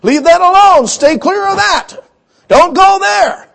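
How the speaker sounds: a high, loud firm warning; God warning. Retold or imitated. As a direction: stop him in his tracks, God's commanding caution. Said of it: "he says".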